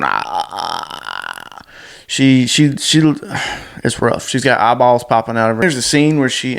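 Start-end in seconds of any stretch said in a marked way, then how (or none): 0.0-1.6 very low, hushed, guttural groan from the second head; monster head groan. Retold or imitated. The head growing on the monster's back groans in a very low, quiet voice. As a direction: let a deep, dragging groan fall out of the monster's second head. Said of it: "a head on her back that's just going".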